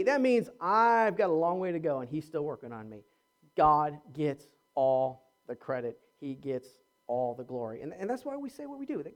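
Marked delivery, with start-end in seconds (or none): none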